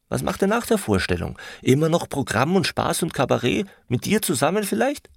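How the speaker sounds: high, rising indignant questions; jealous needling. A narrator's reading. Franz presses Aneschka about Heinzi, jealous and needling.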